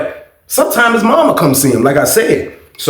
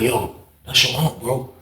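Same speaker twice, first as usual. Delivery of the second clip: much softer, hushed warning to a friend; 6 semitones lower, 10 dB quieter than usual. Retold or imitated. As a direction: drop it to a low urgent hush. Roommate warns of aunt at door.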